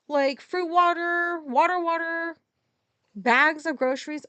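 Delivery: high and loud, worked-up rant; loud venting. Real, not acted. Ranting to viewers about the grocery worker, loud and high. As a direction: let the anger spill out loud and high. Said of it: "I talked too loud for her".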